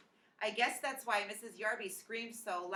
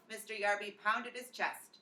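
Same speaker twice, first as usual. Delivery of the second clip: ordinary delivery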